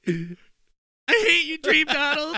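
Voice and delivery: mock crying girlfriend. Imitating his crying girlfriend in a whiny high voice. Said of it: "crying and shit".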